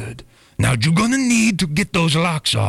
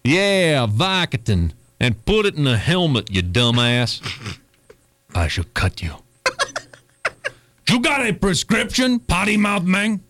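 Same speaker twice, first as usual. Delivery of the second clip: a hint of a Cuban gangster accent on pharmacy talk; Tony Montana impression. Pharmacist Tony greets his customer, in a light Cuban gangster accent. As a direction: lightly play Scarface behind the counter. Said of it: "Please say hello to Tony Montana".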